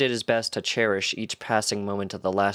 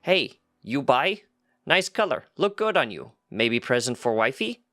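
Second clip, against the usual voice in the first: pushy goat merchant; 4 semitones higher than usual. A narrator's reading. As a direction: hawk the scarf in broken words, pitched up. Goat merchant, broken speech.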